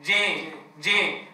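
جي with the alif bent toward an ee sound; Syrian-style imala on جي; alif leaning to ya. Shown as a demonstration. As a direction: tilt the alif of جامع into جي. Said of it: "بعض المناطق في سوريا بيقول جامع".

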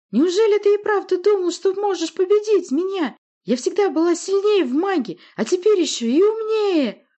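very high, loud screech; squealed boast. A narrator's reading. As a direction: shriek in crazed triumph. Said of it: "завизжала она".

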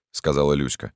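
plain reading voice

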